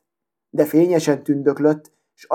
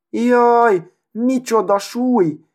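much higher, slow, outraged little needle voice; indignant outcry; 8 semitones higher, much slower than usual. A narrator's reading. The needle crushed under a cart wheel cries out, indignant. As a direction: wail it in high-pitched affront. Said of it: "kiáltott fel méltatlankodva".

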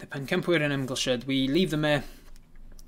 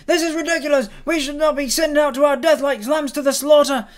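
high, loud, frantic rant; ranting gnome; 10 semitones higher, 8 dB louder than usual. Acted. Rock gnome ranting to the crowd about dragons, frantic. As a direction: rail against being kept prisoner.

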